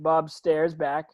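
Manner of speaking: ordinary talk, nothing marked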